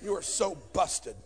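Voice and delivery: ordinary talk, nothing marked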